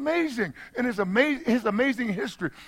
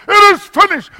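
very loud, high proclamation; triumphant shout, climbing to a peak; exclamation-point shout; 10 semitones higher, 15 dB louder than usual. Shown as a demonstration. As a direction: cry it out with full preaching force. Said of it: "That's an exclamation point".

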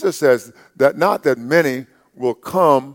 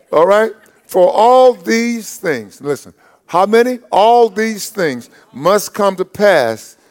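raised, ringing preacher's voice, slowing for emphasis; full-force preaching; 5 semitones higher than usual. Acted. Pastor rousing his church to stay untroubled, preaching hard. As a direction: drive it home to the congregation.

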